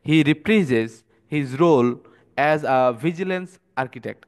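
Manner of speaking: He reprises his role read slowly and loudly; slow, emphatic reading of the example sentence. Shown as a demonstration. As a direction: read out the English example word by word. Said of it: "এক্সাম্পলটা কি বলছে".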